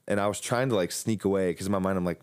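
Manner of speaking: ordinary delivery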